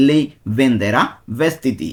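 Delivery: slow, drawn-out Lei venderà vestiti; slow demonstration. Shown as a demonstration. Teacher showing students a sentence, very slow. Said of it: "first take of the demo".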